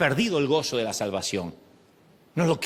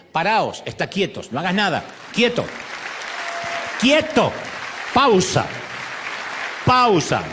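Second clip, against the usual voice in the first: building louder and higher shouts of "quieto" and "pausa"; God's command to stop; 3 semitones higher, 5 dB louder than usual. Acted. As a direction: shout it, stronger each time. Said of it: "dice Dios". (órdenes de Dios cada vez más fuertes)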